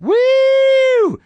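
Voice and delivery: high, loud party woo. Retold or imitated. Comic closing the bit, loud high woo. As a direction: belt a long woo. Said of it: "prove my point that".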